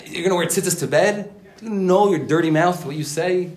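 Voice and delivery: high, incredulous taunting; accusing inner voice. Acted. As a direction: play the nagging critic in one's head. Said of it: "all these voices in my head saying".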